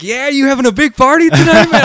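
loud, very high imitated whoop; hollered party banter. New neighbor playing along with gestures, mock holler. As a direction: shout it like a hyped-up party guy.

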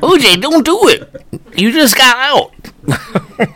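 high, laughing comic plea; mock pleading. Bystander begs O J in court, high mock pleading. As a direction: plead in a high voice, slowing on the second sentence.

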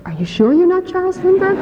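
high-pitched, disbelieving retold question; incredulous questioning. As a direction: press the question in mock disbelief. Doubting stranger asks after a denial.

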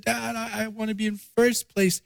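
high, soft little-girl voice, lightly imitated; daughter. Daughter answers dad's question, high soft child voice. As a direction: play his small daughter quietly insisting. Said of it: "And she said".

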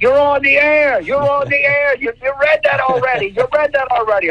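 high-pitched retold yelling of a frantic director; imitated screaming. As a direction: mimic someone screaming into an earpiece. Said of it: "he starts screaming in my ear".

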